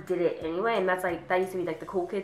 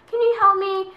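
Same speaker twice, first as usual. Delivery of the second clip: high, loud begging; mock pleading classmate; 12 semitones higher, 7 dB louder than usual. Lazy classmate begging for help, high mock plea. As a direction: imitate a helpless moocher, pitched way up. Same classmate voiced again.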